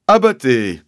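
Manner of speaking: "Abattez" with a hard B for P, loud and drawn out; P turned to B. A narrator's reading. Sergeant repeating the accusation, "Abattez" said with B for P. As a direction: draw out "Abattez", B in place of P. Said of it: "remplacer les P par des B".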